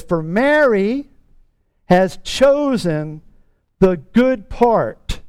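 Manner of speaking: very slow, each word weighted; deliberate, drawn-out verse; slow emphatic reading. Acted. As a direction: lay out "For Mary has chosen the good part" slowly. Key verse read slowly.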